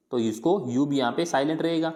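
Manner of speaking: ordinary talk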